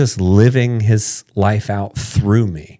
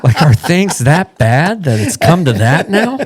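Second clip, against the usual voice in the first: laughing mock alarm; mock shock; 8 semitones higher, 5 dB louder than usual. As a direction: act scandalized, tongue in cheek. Mock incredulous retelling.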